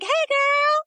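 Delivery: high, loud gushing hello; bubbly greeting. Retold or imitated. Calling her pregnant friend, gushing high and loud. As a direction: squeal a cheery hi to a friend.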